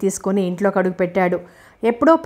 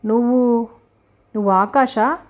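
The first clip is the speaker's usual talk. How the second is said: surprised; 3 semitones higher than usual. Acted. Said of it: "ఆమె ఆశ్చర్యంగా చూస్తూ".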